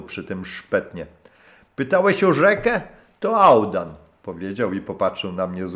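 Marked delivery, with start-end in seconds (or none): 1.8-3.9 put-on high, loud hearty old sailor's voice; a rough old skipper warming to his guest; gruff old captain. (serdeczny, szorstki głos starego kapitana)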